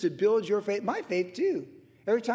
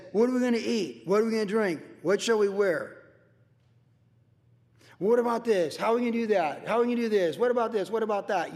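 mock-worried questions, higher and pressing; acted worry; 4 semitones higher, 3 dB louder, faster than usual. Preacher imitates worried people questioning everything, mock-anxious. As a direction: fire off anxious questions, pitched up. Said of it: "what it sounds like when you're worried".

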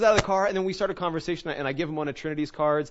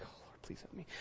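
barely audible, very soft whisper; whispered prayer; 26 dB quieter than usual. Retold or imitated. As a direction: whisper a hasty private plea for help. Retold panicked private prayer.

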